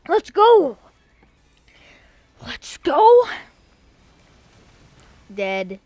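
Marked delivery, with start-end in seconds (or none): 0.1-3.2 high, loud hype shout; real burst of gamer hype; excited cheer. Real, not acted. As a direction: cheer the big win at the top of your voice. Cheering on a game win.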